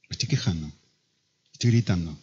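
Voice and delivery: put-on low, subdued child's admission; niña arrepentida. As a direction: play a chastened daughter, soft and low. Chastened daughter answering father, retold. (confesión de niña, en voz baja y apagada)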